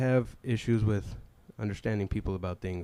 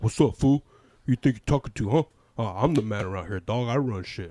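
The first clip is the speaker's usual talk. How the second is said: louder swaggering boss voice; tough-girl bravado; 8 dB louder than usual. Retold or imitated. Coworker bossing new guy around, louder swagger. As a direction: puff up and talk tough.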